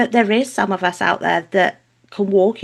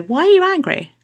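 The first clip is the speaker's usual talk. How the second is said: higher-pitched questioning of an angry relative; acted challenge; 9 semitones higher, 3 dB louder than usual. Mother to her angry family, acted challenge. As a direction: act out calling someone on their anger.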